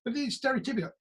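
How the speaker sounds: ordinary delivery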